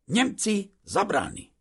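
high, nervous explanation; trembling voice. A narrator's reading. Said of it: "nem csak a hangja reszketett". (félénken, magas hangon magyarázva)

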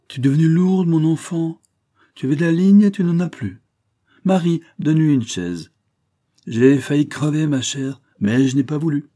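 an aged master who lashes out and then boasts; old painter, blunt. Acted. Old painter bluntly telling his former wife she is heavy.

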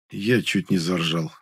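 plain reading voice

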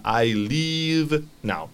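"I leave now" with a long ee in leave, slow; long vowel in leave. Shown as a demonstration. Teacher shows leave versus live to the student, stretched vowel. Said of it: "mantener un poquito más la pronunciación de la vocal".

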